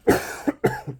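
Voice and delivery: short throat-clearing cough; sudden dry cough. Real, not acted. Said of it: "excuse me".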